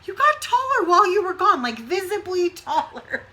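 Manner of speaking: high, loud, laughing insistence; retold mock-outraged amazement, breaking into a laugh; amazed insisting. Mother insisting to her son he grew taller, high laughing amazement. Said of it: "I'm like".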